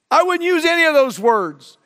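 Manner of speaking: high, loud burst of preaching; fiery outburst at the congregation; preaching shout. Real, not acted. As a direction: belt it out from the pulpit. Preacher erupts at full force.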